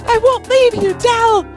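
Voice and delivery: very high, loud defiant cry; desperate shout. Acted. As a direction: refuse with all your heart. Adam refuses to leave.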